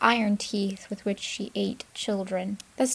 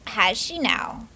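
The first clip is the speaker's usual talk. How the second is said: slow, louder, sly witch musing; wicked witch; 5 dB louder, slower than usual. Acted. Witch hears why her niece came, slow and wicked. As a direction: savour it with a wicked smile. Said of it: "Baba Yaga smiled wickedly".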